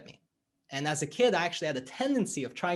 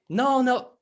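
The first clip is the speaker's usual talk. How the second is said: higher, louder mock protest; acted client protest; 10 semitones higher, 8 dB louder than usual. Client protesting as the coach grabs the backpack, higher and louder. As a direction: blurt out a quick objection.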